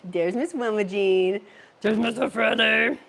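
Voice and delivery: loud, excited pet voice greeting friends; big, drawn-out cartoon greeting; voicing the dog. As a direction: belt out a playful hello as the dog. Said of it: "Oh, he's like".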